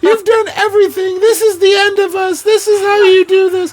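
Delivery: high-pitched, melodramatic wailing; mock inconsolable partner. Imitating partner threatening to end it all, mock inconsolable wail. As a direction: wail it as an over-the-top tirade. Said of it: "she's inconsolable".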